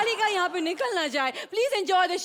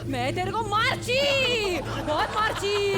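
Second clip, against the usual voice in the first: put-on slow, drawn-out Bengali-accented threat; mock possession in a Bengali accent, endings stretched; possessed Bengali Manjulika. Refused the bathroom, turns possessed Manjulika with Bengali accent. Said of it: "আমি মঞ্জলিকা".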